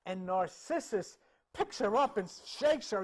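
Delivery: ordinary delivery, nothing marked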